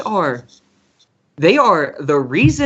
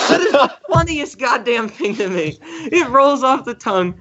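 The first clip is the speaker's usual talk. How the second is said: laughing through it; 8 semitones higher than usual. Real, not acted. Laughing at the funny name.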